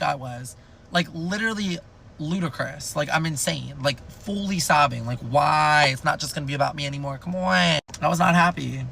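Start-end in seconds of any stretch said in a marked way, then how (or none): none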